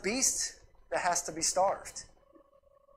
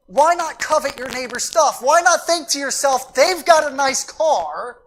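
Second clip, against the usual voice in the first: louder, higher, driving the point home; rising loud preaching; 5 semitones higher, 9 dB louder than usual. Real, not acted. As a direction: preach it with building force. Preacher builds to full force.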